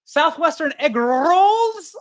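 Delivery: high, drawn-out, hammed-up Mexican accent; mock Mexican accent. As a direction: overdo the Mexican accent like a mother-in-law ordering. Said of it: "starts speaking in a Mexican accent".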